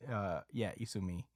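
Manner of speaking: usual speaking voice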